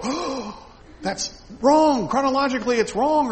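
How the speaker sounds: high, loud fussy protest; mock shocked objection. Modern reader objecting to Gospel order, high mock outrage. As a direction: play a scandalized modern reader. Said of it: "We might go".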